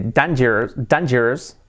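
dangerous wrongly as DAN-jers; pronunciación incorrecta. Shown as a demonstration. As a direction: say "dangerous" with an 'a' as in Spanish. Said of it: "yo solía decir". (dangerous mal dicho, 'dan-yers')

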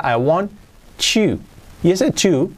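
ordinary talk, nothing marked

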